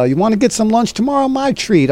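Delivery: mock eager buddy inviting him to lunch; imitating Don. Retelling Don offering lunch.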